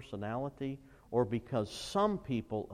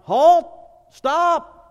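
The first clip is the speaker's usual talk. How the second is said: high, full-force emergency yell; yelled warning; 20 dB louder than usual. Acted. Parent to child running in front of a car, yelled warning. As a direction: yell to stop someone in danger. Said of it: "do you yell out".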